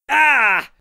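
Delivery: high, loud shocked scream; dad scream. A narrator's reading. Dad seeing petals in Michael's hands, a high loud scream. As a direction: shriek at the ruined garden. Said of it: "screamed Dad again".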